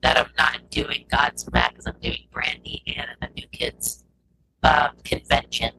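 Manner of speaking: glitchy robotic audio. Real, not acted. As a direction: voice breaking up like a robot. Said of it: "your voice went real robotic real quick".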